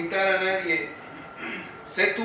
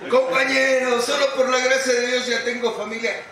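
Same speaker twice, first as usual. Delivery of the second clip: louder, higher, puffed-up speech to the group; proud announcement; 5 semitones higher, 3 dB louder than usual. Retold or imitated. Bragging to the companions at the meeting, raised proud voice. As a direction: announce it like a proud member showing off. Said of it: "Y me subí aquí y decía".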